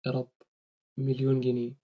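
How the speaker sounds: quiet, offhand mock plea for a million pounds; lightly imitating a careless wish; سخرية خفيفة من دعاء طماع; mock greedy prayer. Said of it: "ما ينفعش تدعي ربنا كده".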